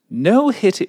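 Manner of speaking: high mock British accent. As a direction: start the rule in a put-on British voice. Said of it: "Oh, wait, I'm not British".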